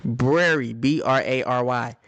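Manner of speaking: Brary stressed, then B R A R Y spelled out letter by letter. Shown as a demonstration. Mother drilling the spelling for her kids: letter by letter. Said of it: "No, library".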